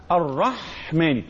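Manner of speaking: "الرحمن" slowly, lingering on a breathy pharyngeal ح; bahha in the ha. Shown as a demonstration. Teacher showing what marks out the ha, slow bahha demonstration. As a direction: draw out the throat friction of ح inside "الرحمن". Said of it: "الحاء تتميز بالبحة".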